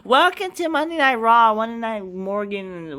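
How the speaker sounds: mock the catchphrase, dragging the end; mocking wrestler catchphrase. Vlogger mocks wrestlers' catchphrases, high sing-song. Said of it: "have to say".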